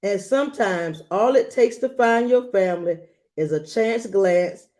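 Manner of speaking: put-on gentle read-aloud to a child; storybook reading voice; story narration. A narrator's reading. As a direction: read it like a bedtime picture book. Reads picture book aloud.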